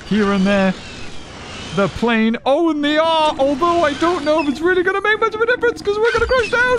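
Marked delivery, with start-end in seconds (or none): 2.4-6.8 excited high. Real, not acted. Excited game commentary.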